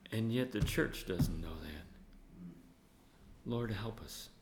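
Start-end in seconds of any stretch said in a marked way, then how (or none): none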